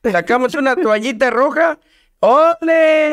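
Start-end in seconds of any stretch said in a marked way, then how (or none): 2.2-3.1 high, drawn-out "¡Ole!"; playful stretched cheer, pitched up; mock bullfight cheer. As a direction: cry olé as if at a bullring. Acts out bullfighting the chiles.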